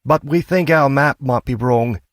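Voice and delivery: lifted, apologetic woman's voice; middle-aged woman. A narrator's reading. Wife admitting the map's wrong, lifted apologetic voice. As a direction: say it lightly, half-embarrassed.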